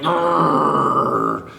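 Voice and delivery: playful growling rumble for a hungry belly; tummy growl. Pretend.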